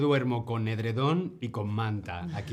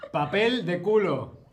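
laughing guess; 5 semitones higher, 5 dB louder, slower than usual. Real, not acted. Laughing guess of toilet paper.

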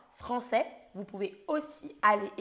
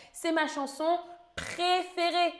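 raised, bright answer; 6 semitones higher than usual. Shown as a demonstration. Teacher revealing the correct answer, pitched up. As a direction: say the answer higher to mark it. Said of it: "c'est la première réponse, la correcte".

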